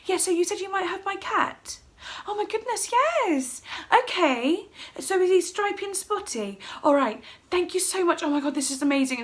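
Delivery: high, over-polite phone manner; breathless-with-excitement courteous phone call; frantic polite telephone voice. Retold or imitated. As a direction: speak high and politely, barely containing excitement. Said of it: "doing a polite telephone voice".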